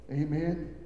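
normal talking voice

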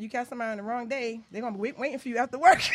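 fast, rising to a loud laughing shout; half-joking threat; laughing warning. Retold or imitated. Warning the stylist about the wrong day, fast, laughing and loud. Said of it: "And I said".

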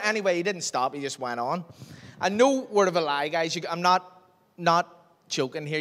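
ordinary talk

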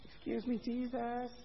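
preacher mocks a timid request to Jesus; timid whisper. Shown as a demonstration. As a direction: whisper it as if afraid to disturb. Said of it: "No, no".

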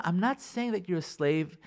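ordinary talk, nothing marked

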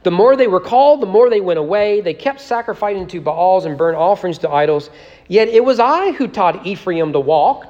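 reading scripture aloud with rising fervor. Acted. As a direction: give the prophet's words full, ringing weight. Reads Hosea aloud to congregation.

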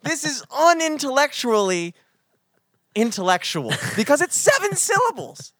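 high-pitched, worked-up boasting; gleeful, over-excited proof; triumphant rant. Real, not acted. Triumphant mock rant over new word.

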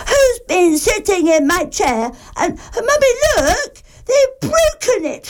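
little bear tells his mummy, high and upset; Baby Bear crying. Acted. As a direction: wail it to Mummy. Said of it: "and cried".

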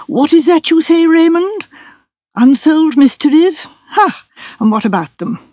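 lightly voiced old lawyer. A narrator's reading. Solicitor questioning Raymond, lightly voiced dry old man. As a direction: pick the words apart like a fussy lawyer.

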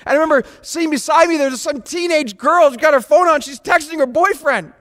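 high, loud, fast story; fervent sermon anecdote; excited storytelling. Real, not acted. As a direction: carry them along with mounting energy. Excited story at full force.

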